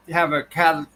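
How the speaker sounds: ordinary talk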